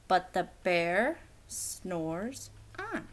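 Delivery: drawn out, soft and slow; slow refrain. A narrator's reading. Reading the refrain to the listener, slow. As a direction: let it settle, slowly.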